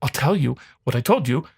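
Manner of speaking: ordinary talk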